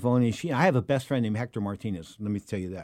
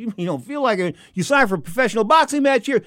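raised, loud indignation; incredulous protest; 10 semitones higher, 6 dB louder than usual. Retold or imitated. Host to fighter who signed for the match, indignant. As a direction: throw the refusal back in disbelief.

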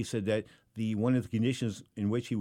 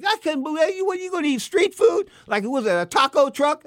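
high, loud mock Don King, much higher than his own voice; imitating Don King; 8 dB louder than usual. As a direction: squeal it out as an upset Don King scolding. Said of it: "He was like".

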